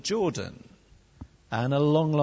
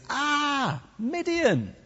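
high, hammed-up wail of fright; panicked whinge; 11 semitones higher, much slower than usual. Gideon in the winepress fearing the Midianites, panicked whinge. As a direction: cry out in mock panic, pitched way up. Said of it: "first thing Gideon does is whinge".

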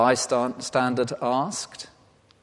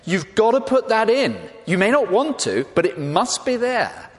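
higher, insistent imagined Peter; Peter insisting; 9 semitones higher than usual. Retold or imitated. Peter urging disciples to record his failure, insistent. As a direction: press the writers hard to keep it in. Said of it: "You can almost imagine Peter saying".

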